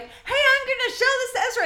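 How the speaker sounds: high, loud, thrilled announcement; beaming excitement. Retold or imitated. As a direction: burst in proud of the find. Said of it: "beaming in the back door like".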